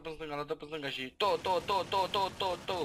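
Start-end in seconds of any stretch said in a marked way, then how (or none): 1.2-2.8 high, loud staccato syllables; rhythmic "to-to-to". Pretend. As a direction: fire off mock repeated shots of sound, pitched up. Mimicking rapid repeated sound mid-game.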